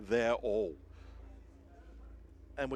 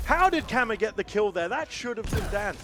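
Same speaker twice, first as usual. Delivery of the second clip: high, loud, stunned question; can't believe what just happened; surprised; 9 semitones higher, 9 dB louder than usual. Real, not acted. Co-caster reacts to shock kill.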